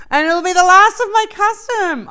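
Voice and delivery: high excited scream; squealing with delight. Real, not acted. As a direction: scream it out with real thrill. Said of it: "I'm screaming".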